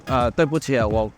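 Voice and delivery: ordinary delivery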